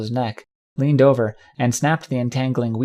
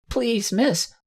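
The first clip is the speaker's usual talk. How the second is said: put-on tearful, high-pitched child's whimper; pleading little colt near tears; Rumble; 10 semitones higher than usual. A narrator's reading. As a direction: beg in a small, high whimper. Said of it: "a tearful Rumble was whimpering, begging".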